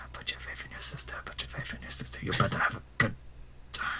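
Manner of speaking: hushed, whispered self-reminder; whispered mantra, very soft; muttering to self. Acted. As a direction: talk yourself down under your breath. Calming self under breath before fight.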